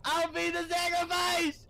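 mock heroic.

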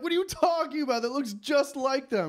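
high-pitched, indignant disbelief; acted incredulous protest. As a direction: insist as if everyone else is crazy. Acting out disbelief at friends disagreeing.

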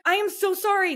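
high-pitched, pleading mock apology; cornered apology. Breeder retelling apology to angry buyers, cornered. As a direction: re-enact a pressured, overwhelmed sorry. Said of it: "I'm just cornered".

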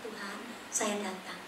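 slow, soft whispered-down prayer; soft slow morning prayer; hushed prayer. Retold or imitated. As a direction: pray it quietly, almost to yourself.

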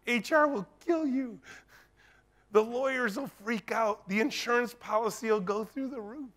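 hammed-up fearful inner warning; dark inner voice. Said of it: "The dark voice said".

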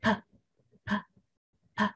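P with lips pressed, then released in a puff; isolated, voiceless burst of P; popped P. Shown as a demonstration. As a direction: press the lips and pop out each P. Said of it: "Chúng mình mím môi và bật ra".

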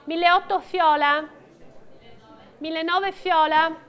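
steady rhythmic bid calling; auctioneer calling bids. Real, not acted. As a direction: call out each bid to the room.

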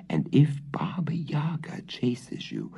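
whispered urgent warning; hushed warning. Acted. The cat whispers its warning to Marusha. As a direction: breathe it as a secret. Cat warns girl in secret.

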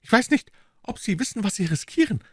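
very high, put-on furious voice; sudden angry outburst. A narrator's reading. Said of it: "rief Mister Jones in einem plötzlichen Wutausbruch". (plötzlicher Wutausbruch mit hoher Figurenstimme)

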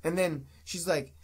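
normal talking voice

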